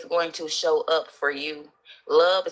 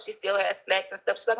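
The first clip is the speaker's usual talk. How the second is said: usual speaking voice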